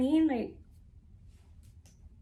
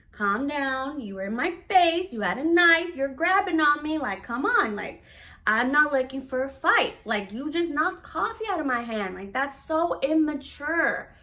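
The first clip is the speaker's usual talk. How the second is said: louder, higher, exasperated telling-off; heated scolding; 5 semitones higher, 7 dB louder than usual. Retold or imitated. Daughter scolding her mother after the coffee slap, loud and exasperated. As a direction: call her out, fed up and heated.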